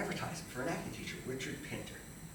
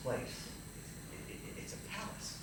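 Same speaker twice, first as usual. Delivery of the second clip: hushed awe; 7 dB quieter, slower than usual. Retold or imitated. Recalling awe inside the theater.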